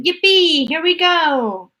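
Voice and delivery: high, loud, stretched cheer; gleeful shouting. A narrator's reading. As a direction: cheer as it shoots up. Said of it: "shouted Grandpa Joe again".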